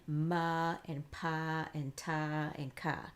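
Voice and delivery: slow "ma", "pa", "ta", "ka", each with an extra vowel; wrong added vowel. Shown as a demonstration. Teacher demos the wrong way, slowly. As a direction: drag a vowel onto every consonant. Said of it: "We're not saying".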